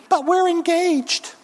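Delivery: high, slow mimicked objection; mock protest. Preacher mimicking an engaged couple's objection, mock protest.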